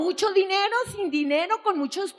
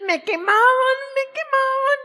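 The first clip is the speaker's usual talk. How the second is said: desperate cry; 7 semitones higher than usual. Retold or imitated. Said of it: "Yo desesperada".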